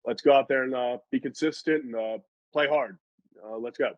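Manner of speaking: imitating a deadpan pregame speech; mock monotone pep talk, halting on the uhs. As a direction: deliver a hype speech with zero hype. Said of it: "in the most monotone voice".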